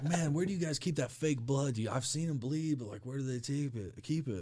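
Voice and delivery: high, puzzled coworker voice; naive coworker. Retold or imitated. Coworker asking where wrestlers keep blood, high puzzled voice. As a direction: pitch it up like a guy who truly believes it's fake.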